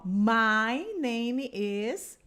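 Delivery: very slow, clear My name is; slow model phrase. Shown as a demonstration. Teacher modelling My name is slowly for students. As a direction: spell it out slowly for learners. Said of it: "você fala essa frase assim".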